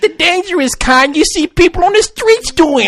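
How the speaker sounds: high, slow fake Brooklyn accent; hammed-up Brooklyn pitchman. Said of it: "I like the way he said".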